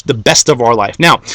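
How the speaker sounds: normal talking voice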